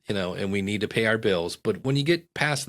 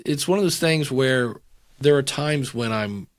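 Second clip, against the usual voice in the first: usual speaking voice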